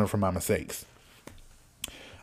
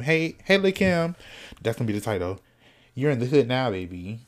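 ordinary talk

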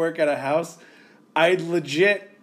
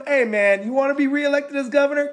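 angry rant; 8 semitones higher than usual. Real, not acted. Podcast host ranting at the governor, furious. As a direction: vent hard, pitched way up. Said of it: "That's passion right here".

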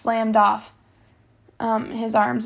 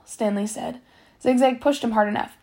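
ordinary delivery, nothing marked

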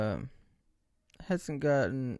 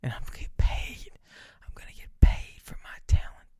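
breathy whispered aside; conspiratorial whisper to the camera. Acted. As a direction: whisper it like a secret plan.

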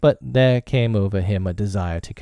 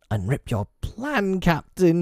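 high, eager, drawn-out plea; eager cry; 10 semitones higher than usual. A narrator's reading. Smee begs Hook for his plan, eagerly. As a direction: beg for it eagerly, pitched high. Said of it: "he cried eagerly".

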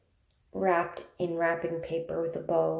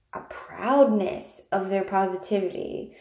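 ordinary delivery

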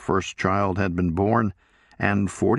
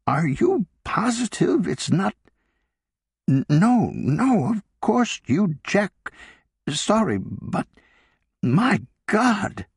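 put-on a higher older woman, stammering in shock; flustered wife checking facts on the phone, higher; Sarah, shaken; 12 semitones higher, slower than usual. A narrator's reading.

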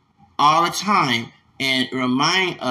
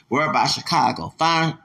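ordinary talk

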